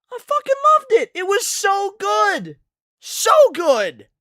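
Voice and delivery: excited. Real, not acted. Gushing over the movie.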